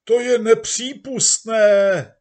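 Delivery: high, loud, slow indignant yell; crowd shouting. Acted. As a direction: bellow it out as an outraged crowd. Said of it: "Masa pracujících křičela". (rozhořčený křik davu)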